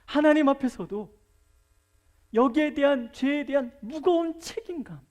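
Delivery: preacher driving home the point about grudges; preaching at full force. Real, not acted. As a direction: preach it to the back pew.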